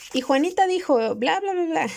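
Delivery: high, playful mock voice for Juanita's chatter; lightly voiced babbling girl, teasing; mock silly voice. Mocks a badly written dialogue. (voz burlona y aguda de Juanita)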